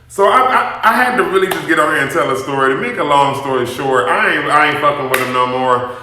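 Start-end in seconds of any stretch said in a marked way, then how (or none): none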